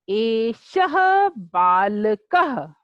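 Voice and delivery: एषः बालकः said very slowly, each syllable clear, visarga breathed out; slow model pronunciation. Shown as a demonstration. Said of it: "तो आप इसका उच्चारण कीजिए". (धीमा, स्पष्ट उच्चारण)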